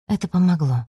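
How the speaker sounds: plain reading voice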